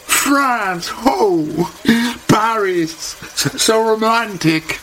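very high, swooning over Paris; dreamy high gush; gushing American hairdresser. Acted. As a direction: coo it, starry-eyed and slow. Holly swoons over France.

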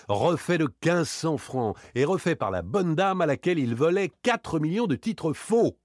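high, emphatic self-mockery; amused outrage. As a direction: savour the absurdity of being conned. Gleeful disbelief at being swindled. (autodérision, faussement outré)

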